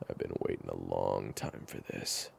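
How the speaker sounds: low, sinister threat; menacing. Acted. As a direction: savor the threat.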